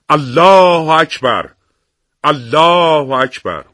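loud takbir. A narrator's reading. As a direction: cry God is great, stretched long and loud. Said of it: "با صدای بلند تکبیر سر داد". (تکبیر بلند و کشیده)

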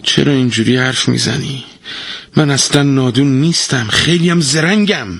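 wounded pride in the voice; hurt. Acted. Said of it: "با دلخوری گفت".

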